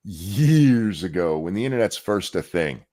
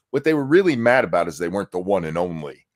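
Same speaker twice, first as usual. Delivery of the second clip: usual speaking voice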